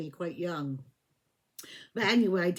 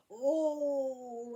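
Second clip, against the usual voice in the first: sleepy oh; 4 semitones higher, 3 dB quieter than usual. Retold or imitated. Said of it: "like I just got out of bed".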